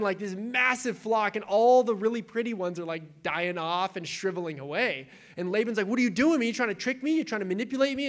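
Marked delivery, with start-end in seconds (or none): 5.9-8.1 higher, louder indignant accusation; a hammed-up suspicious challenge; accusing. Laban accusing Jacob.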